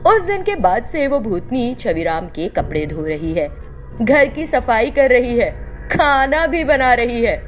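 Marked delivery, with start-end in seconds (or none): none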